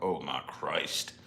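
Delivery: low, soft, slow groan of despair; exasperated mutter. Retold or imitated. Parent worn down by daughter's chatter, a slow low groan. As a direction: sigh it out, defeated. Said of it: "and it's just like".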